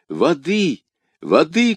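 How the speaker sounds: high, urgent calls; crying out. A narrator's reading. D'Artagnan calls for water for her, urgent cry. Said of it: "кричал Д'Артаньян".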